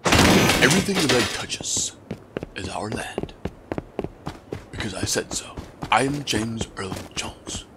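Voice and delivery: a hint of a stately lion king; James Earl Jones impression. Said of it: "I can do Mufasa, though. James Earl Jones".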